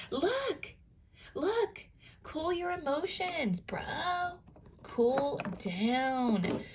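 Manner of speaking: high, light scolding of a hothead; a hint of a big-sibling telling off; mock scolding. A sign scolding Aries, lightly mock stern.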